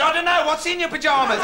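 high-pitched shouted retort; neighbour calling back. Retold or imitated. Neighbour answering Kevin's shout in the road, calling back. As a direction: toss the question back across the street.

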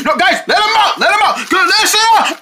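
frantic high shouting; panicked high yelling. Acted. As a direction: scream for them to leave.

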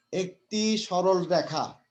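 very slow, word by word; teacher slowly reading the hard fifth postulate; slow dictation. Shown as a demonstration. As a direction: read it out so they can follow. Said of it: "আমি আস্তে আস্তে বলছি".

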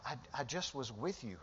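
a soft, mild reminder. Acted. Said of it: "he's like".

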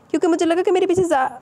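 high, urgent start cut off at زا; breaking off mid-word. A narrator's reading. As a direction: start explaining, then stop dead on زا. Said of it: "وہ کچھ بولتے بولتے رکے". ("زا" پر بات ادھوری چھوڑتے ہوئے)

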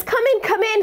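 high, eager beckoning; urging welcome. Retold or imitated. Dog owner urges the scared collector inside, high welcome. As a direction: imitate a host calling a guest inside.

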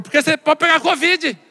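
high-pitched, insistent comeback; exasperated retort. Acted. Hospital worker refusing a son's visit, exasperated retort. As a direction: throw the reason back in a high voice. Escalating argument, woman answers back.

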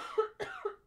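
hard high choking cough; hacking cough on water. Real, not acted. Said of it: "You sound like you're dying".

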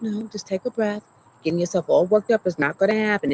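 a hint of a firm mother, mildly voiced; mama calming her down. Her mom at the clinic calming her panicking daughter, lightly voiced firm mother. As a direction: lightly play her mom telling her to breathe. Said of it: "that sounds like my mama".

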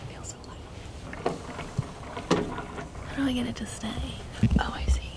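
soft unvoiced whisper. Real, not acted. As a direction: whisper so no one wakes. In capsule, others sleeping.

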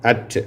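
at said clearly with an open a sound; English demo word at; model word at. Shown as a demonstration. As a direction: show them how at sounds. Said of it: "எந்தெந்த வார்த்தையில வருது?".

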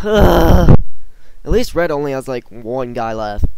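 loud and fast with excitement; excited. Real, not acted. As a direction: keep the energy up. Excited mid-fight callout.

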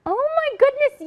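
excited. Real, not acted. Reacting to skydive video.

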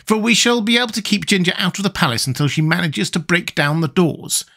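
high, reassuring character voice; mildly hopeful tin man; attempted cheerfulness. A narrator's reading. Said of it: "trying to speak cheerfully".

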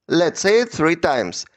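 usual speaking voice

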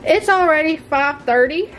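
usual speaking voice

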